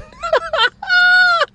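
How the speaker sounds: a big high burst of laughter; shrieking laugh at his own joke; loud high laugh. Real, not acted. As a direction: crack up loudly at the punchline.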